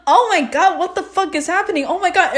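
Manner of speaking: high, worked-up panic; imitated freak-out. Teasing friend about reaction to the movie, freaking out. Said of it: "so freaking out".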